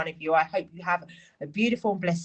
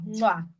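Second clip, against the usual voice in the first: blown kiss; 4 semitones higher than usual. Real, not acted. Kiss goodbye to viewers.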